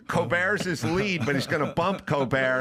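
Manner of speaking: ordinary delivery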